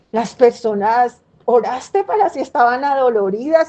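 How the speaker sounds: normal talking voice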